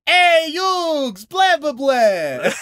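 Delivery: high, loud, slow cartoon vampire; hammy vampire. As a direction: ham up a Dracula taunt.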